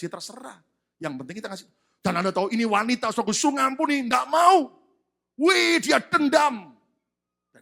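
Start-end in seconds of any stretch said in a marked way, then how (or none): 2.0-6.7 loud, rising preacher's cry; impassioned preaching. Real, not acted. Preacher telling of a wife refusing to forgive, impassioned shout. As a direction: build to a shout over her refusal.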